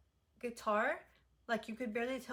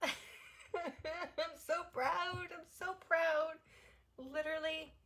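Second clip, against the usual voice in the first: much higher, choked-up proud voice; teary pride; 7 semitones higher than usual. Real, not acted. Fan nearly crying over [name]'s second album. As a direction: squeeze out the pride through tears. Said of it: "I'm gonna cry".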